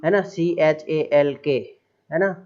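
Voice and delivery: normal talking voice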